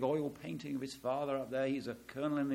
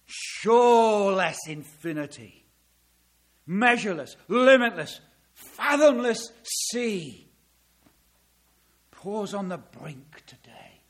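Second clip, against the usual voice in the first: reciting a hymn; 5 semitones higher, 10 dB louder than usual. Acted. Preacher to congregation, reciting the hymn with growing fervour. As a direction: declaim the old hymn with awe. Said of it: "and he wrote like this".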